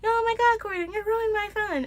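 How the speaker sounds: mock him in a high whine; a hammed-up high-pitched bully; mock whiny voice. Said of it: "He's like".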